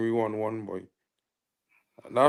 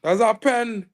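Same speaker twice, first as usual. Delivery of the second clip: insistent shout; 7 semitones higher, 5 dB louder, slower than usual. Real, not acted. Claiming a penalty.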